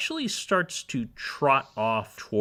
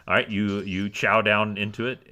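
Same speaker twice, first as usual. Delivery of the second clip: usual speaking voice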